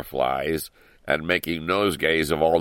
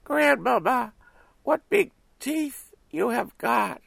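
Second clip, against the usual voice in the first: put-on little girl voice. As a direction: ask like an astonished small girl. Girl questions.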